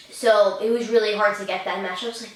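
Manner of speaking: ordinary delivery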